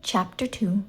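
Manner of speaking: ordinary delivery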